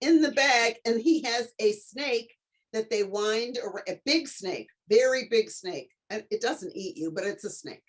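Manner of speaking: normal talking voice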